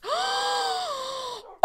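long, high gasp of delight; big high-pitched excited gasp; amazed gasp. Real, not acted. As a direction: suck in air in amazement. Amazed by the ocean dogs.

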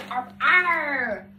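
loud playful pirate growl; pirate arr. Pretend. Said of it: "You sound like a pirate".

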